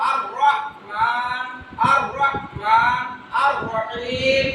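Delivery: slow, drawn-out "আর রহমান", "আর রহিম" with a heavy "র"; full heavy R. Shown as a demonstration. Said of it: "এই অক্ষরগুলো পূর্ণ উচ্চারণ হবে". (টেনে, "র" পূর্ণ উচ্চারণে)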